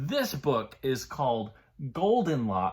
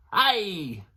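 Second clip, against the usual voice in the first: pirate mama; 6 semitones higher, 5 dB louder than usual. Acted. Menacing Mama Pirate voiced.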